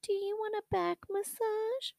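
high, soft sing-song mom voice; mock sweet offer from his mom; lightly mimicking a doting mother; imitating his mom. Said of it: "she would be like".

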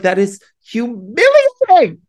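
comic wail of humiliation, high and loud; mock-mortified outburst. Real, not acted. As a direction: shoot up high and loud in embarrassment. Mock outrage at own embarrassment.

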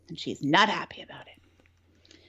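dramatic whisper, low on breath; hushed aside. Retold or imitated. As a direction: drop to a whisper for the ominous bit. Ominous aside in the retelling.

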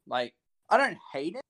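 ordinary talk, nothing marked

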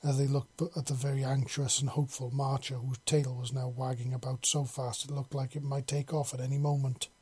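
usual speaking voice

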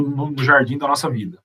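ordinary delivery

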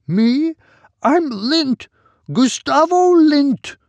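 put-on high, slow reedy old man; thin reedy voice. A narrator's reading. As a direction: say your name slowly, high and thin. Old man gives his name.

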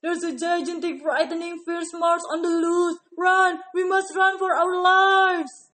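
loud frightened call to run; panicked shout. Acted. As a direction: yell it in terror. Elephant urges flight.

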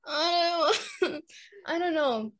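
high voice breaking into giggles; laughing helplessly. Real, not acted. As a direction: answer through an embarrassed laugh. Embarrassed, laughing while answering.

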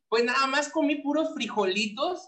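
normal talking voice

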